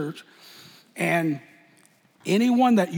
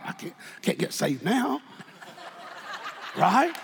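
ordinary talk, nothing marked

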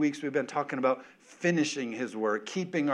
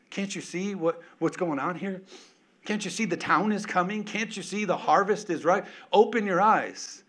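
rising preaching plea; 6 semitones higher, 3 dB louder than usual. Acted.

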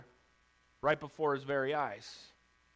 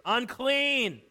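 high, drawn-out cry of warning; 6 semitones higher, 3 dB louder than usual. Acted. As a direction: call it out as a public warning. Leper cries out his warning.

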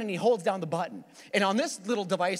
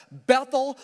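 Bethel lifted high and loud; emphatic Bethel; 5 semitones higher, 6 dB louder than usual. Shown as a demonstration. Preacher savouring the new name, ringing out Bethel. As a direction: ring out Bethel to the congregation.

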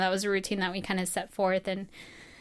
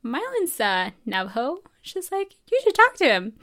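much higher, bright imitation of a friendly mentor; imitating Cicely; 7 semitones higher, 6 dB louder than usual. Cicely pointing out fellow Navajo classmate, higher imitating voice. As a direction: chirp it like an eager older student. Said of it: "I remember Cicely was like".

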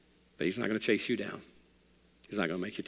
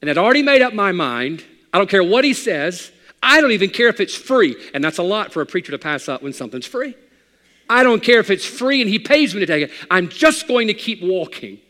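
loud, high preaching cry; full-force preaching; 8 semitones higher, 13 dB louder than usual. Acted.

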